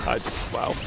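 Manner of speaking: a hushed, disbelieving wow; stunned whisper. Real, not acted. Whispering in disbelief at the empty town. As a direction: breathe it out, stunned.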